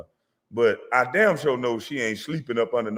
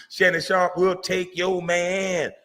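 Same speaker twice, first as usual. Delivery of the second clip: slow mock warning; 6 semitones higher, slower than usual. Teasing warning to Savannah.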